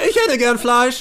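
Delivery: loud, high begging cellmate; eager prisoner. Acted. Prisoner begging cellmate for meat, eager and greedy. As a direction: ask greedily for your share.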